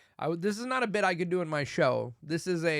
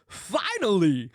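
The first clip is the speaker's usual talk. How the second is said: loud, drawn-out exclamation, higher than usual; bad celebrity impression, stretched with relish; Christopher Walken; 5 semitones higher, 7 dB louder, slower than usual. Movie star in sudden close-up, poor impression, loudly. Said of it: "Christopher Walken's face, who goes".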